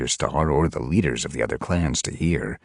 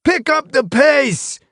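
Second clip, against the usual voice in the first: slow, much higher and louder yell; 8 dB louder, slower than usual. A narrator's reading. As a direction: bark it across the training ground. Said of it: "he yelled".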